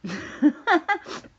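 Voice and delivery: a sudden high giggle bursting out; high burst of laughter. Real, not acted. Laughing after joking to the viewers about the Grabblers. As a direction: laugh off your own joke.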